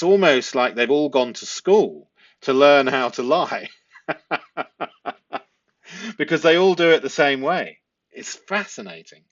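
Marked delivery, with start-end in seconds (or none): 4.1-5.4 short laugh mid-sentence; real amused laugh. Real, not acted. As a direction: laugh at how alike liars are. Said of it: "it makes you giggle".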